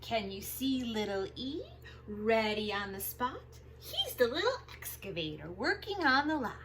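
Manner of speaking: lively picture-book read-aloud; reading to children. Acted. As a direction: read it big and bouncy for little kids.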